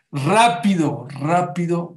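loud, hurried call of Rápido; a doctor pressing the mother to hurry; urgent doctor. Acted. Doctor tells the poor mother to hurry, loud urgent call.

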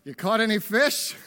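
calling out. Acted. Jesus on the beach hailing disciples in the boat, calling out. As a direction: hail them lightly across the water. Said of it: "he called out".

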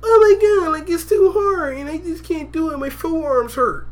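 high-pitched mock complaint, fading as it drags; put-on high, self-pitying moan; whining private. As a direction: whine it like a worn-out recruit.

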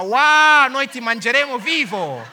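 loud, high roar easing into a shouted threat; booming lion threat. Acted. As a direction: echo a hungry lion's roar. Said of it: "quei leoni andavano".

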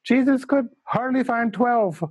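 put-on high, emphatic Indian English accent; Tara Singh's Indian accent. Tara Singh warning students, in an Indian English accent. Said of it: "Indian English accent".

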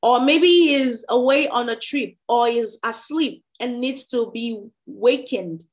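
animated, projected Bible narration; scripture reading aloud. A narrator's reading. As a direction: read the chapter out to the congregation. Reads Bible chapter aloud.

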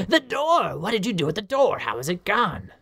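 startled professor. Acted. Professor shocked that the students destroyed the door. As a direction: yelp at the vanished door.

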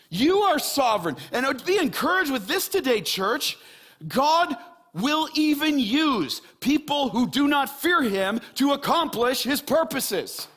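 high, loud, fervent preacher voice; impassioned sermon peak with hard-hit key words; full-force preaching. Real, not acted. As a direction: proclaim it boldly to the whole church.

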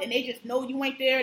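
normal talking voice

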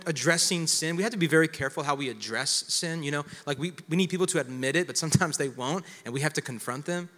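ordinary talk, nothing marked

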